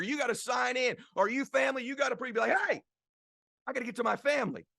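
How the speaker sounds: high, loud motivational preaching; preaching at full force. Acted. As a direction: rouse the crowd, high and driving.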